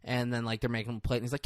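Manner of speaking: usual speaking voice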